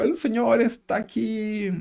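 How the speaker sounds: hammed-up prophetic voice; effusive visionary proclaiming. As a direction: proclaim it like a seer. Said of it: "lo dijo de una forma tan, eh, efusiva".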